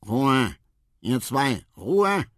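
loud, high call, rising in force; cross shout. A narrator's reading. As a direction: shout down two chatterers. Marabou shouts down the chatting pair. (ärgerlich laut Ruhe rufen)

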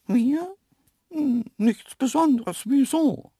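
very high, loud cartoon animal voice; puzzled antelope. Antelope asked about its plans, high puzzled voice. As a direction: answer high and unsuspecting.

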